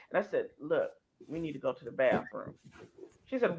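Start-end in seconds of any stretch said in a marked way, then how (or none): 0.6-2.5 low, soft, secretive; hushed. Retold or imitated. Urging Gail toward the bathroom, hushed and low. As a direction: keep it between the two of you.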